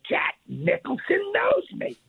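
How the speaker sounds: high, drawn-out thrill of being recognized; starstruck inner gush. Retold or imitated. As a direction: squeal it like a starstruck fan who can't believe it. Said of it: "inside I'm going like this".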